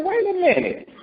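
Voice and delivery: high, stung protest at being sidelined; indignant Moses. Retold or imitated. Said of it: "Well, I say".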